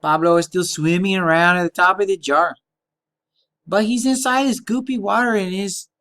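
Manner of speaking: put-on over-the-top Spanish accent; playful Spanish accent voice. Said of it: "Can't do the Spanish accent the whole time".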